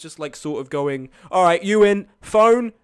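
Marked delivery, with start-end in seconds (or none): 1.3-2.7 loud, higher barking orders at passengers; demanding bus driver. Retold or imitated. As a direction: boss everyone onto the bus. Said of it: "he was really demanding".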